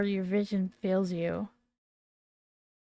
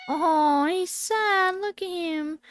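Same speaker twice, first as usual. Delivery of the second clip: much higher, cooing over the sad mouse; pitying coo; 9 semitones higher, 5 dB louder, slower than usual. Real, not acted.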